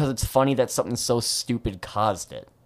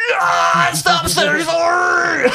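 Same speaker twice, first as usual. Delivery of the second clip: high, loud fed-up yell with a laugh; exasperated shout; 7 dB louder, much slower than usual. Retold or imitated. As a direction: blow up at all the apologizing. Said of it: "Dalton's just gonna be like".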